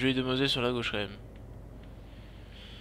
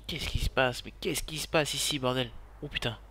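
ordinary talk, nothing marked

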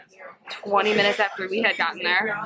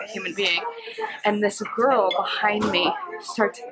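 usual speaking voice

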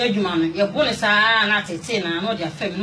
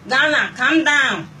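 slow, louder mock call, pitched up; imitated summons; 4 semitones higher, 5 dB louder, much slower than usual. Imitating staff summoning the Ghanaian women, drawn-out calling out. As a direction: draw it out like calling a group. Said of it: "na ɔmo afrɛ".

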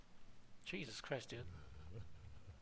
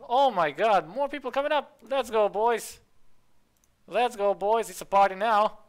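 loud, raised party-starting cheer; excited hype; 6 semitones higher, 7 dB louder than usual. Real, not acted. Streamer as more people come up, hyped and loud.

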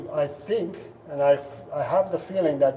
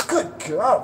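pitched up, happy praise for a dog; high excited praise; 6 semitones higher than usual. Shown as a demonstration. Trainer showing how to praise a beagle, high and excited. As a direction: praise her like she just did great. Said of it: "You focus on praising your beagle".

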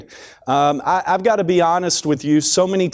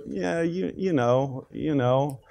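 much softer, noncommittal mumble; half-hearted vague prayer; 8 dB quieter, slower than usual. Acted. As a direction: trail along quietly, as if unsure what to ask. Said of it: "we're just sort of".

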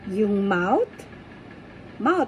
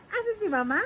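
ordinary delivery

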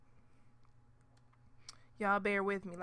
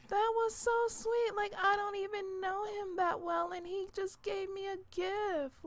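higher and louder, overjoyed; thrilled gushing; 8 semitones higher, 7 dB louder than usual. Real, not acted. Gamer telling viewers about the gift, thrilled gushing. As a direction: gush about the sweet gift.

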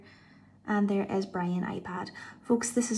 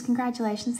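ordinary delivery

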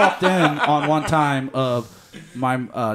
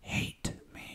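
unvoiced whisper, close to the ear; 14 dB quieter than usual. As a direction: breathe it like a secret confession. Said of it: "she whispers in my ear".

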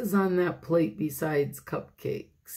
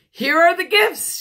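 high, loud, excited announcement; excited high-pitched call; 10 semitones higher, 10 dB louder than usual. Acted. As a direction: present it with a big loud flourish. Character presents gifts, calling out.

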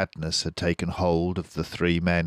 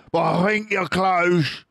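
higher, louder farmer shout; Boggis shouting; 12 semitones higher, 5 dB louder than usual. A narrator's reading. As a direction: yell over the machines. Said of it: "shouted Boggis".

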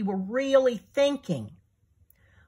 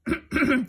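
higher, deliberate throat clearing; 7 semitones higher than usual. A narrator's reading. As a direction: clear the throat before answering. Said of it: "Clearing his throat".

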